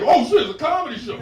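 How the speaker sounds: high, loud imitation of discovery; surprised passerby. People walking past discover a comedy show, loud mock surprise. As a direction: call it out as an amazed passerby. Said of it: "hear people walking past like".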